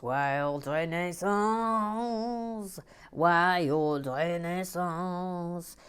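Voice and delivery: slow, stretched announcement of a name; slow sung-out name call; drawn-out name. Acted. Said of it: "drawn-out name calling".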